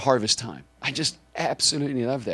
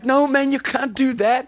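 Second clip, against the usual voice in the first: high, louder mock alarm; high-pitched protest; 13 semitones higher, 5 dB louder, slower than usual. Passenger objecting to the driver stopping, high-pitched protest. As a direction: imitate an alarmed passenger, voice jumping up.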